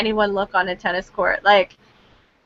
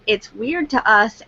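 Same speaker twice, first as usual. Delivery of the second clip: usual speaking voice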